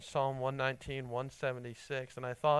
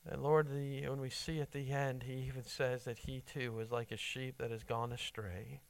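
prayer. Acted. Closing prayer.

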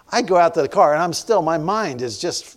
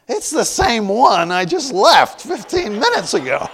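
amazed disbelief; 9 semitones higher, 6 dB louder than usual. Real, not acted. Realizing it's the same Dairy Queen.

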